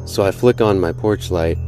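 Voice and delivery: plain reading voice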